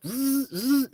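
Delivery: high buzzing razor noise; mimicked clippers, two short buzzes; imitated shaver buzz. Imitates shaver cutting the beard.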